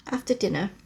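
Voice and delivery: usual speaking voice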